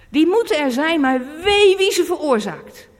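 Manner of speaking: luider uitgeroepen dreiging; luide waarschuwing. Real, not acted. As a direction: roep het wee krachtig uit. Preek over struikelblokken. (luide predikende waarschuwing)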